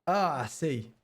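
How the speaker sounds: cowboy voice. Acted. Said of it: "leave the cowboy voice behind".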